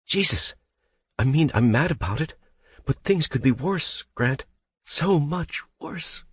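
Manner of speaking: high, strained, fading into a sob; on the verge of sobbing. Acted. Becca on the phone to Grant, near sobbing. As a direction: hold back the sob as it fades. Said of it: "Voice fading into a silent sob".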